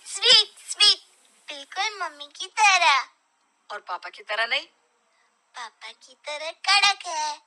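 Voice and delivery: put-on a boy grading dad's tea with a grin; playful child. Son teasing parents about the tea, playful child.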